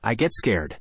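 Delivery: plain reading voice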